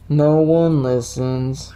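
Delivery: slow, drawn-out woe; very slow mock-forlorn delivery; mock lament. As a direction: stretch it out in hammed-up self-pity. Mock lament repeat of plain line.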